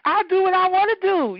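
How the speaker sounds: put-on high, cheeky little girl voice; sassy little kid. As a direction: talk back like a bratty kid. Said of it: "I'm a little kid".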